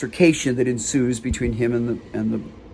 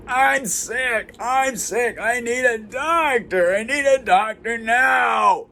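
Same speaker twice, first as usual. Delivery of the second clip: high, drawn-out wail; distressed homeless man; 9 semitones higher, slower than usual. Retold or imitated. Homeless man demands a doctor from the guard, high drawn-out wailing. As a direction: wail the words out slowly, far above your own pitch. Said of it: "homeless man came up".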